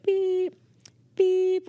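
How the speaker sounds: high, held electronic beep; imitated phone beep. Receptionist imitating the phone in her ear, high beeps. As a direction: mimic a phone tone, steady and high.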